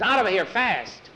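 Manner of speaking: high, pressing call; urgent order. Acted. Ordering boys out.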